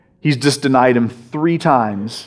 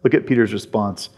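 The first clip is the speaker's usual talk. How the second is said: usual speaking voice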